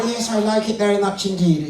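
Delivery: put-on feminine ventriloquist voice. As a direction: answer as a prim lady puppet. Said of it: "let's find a nice feminine voice".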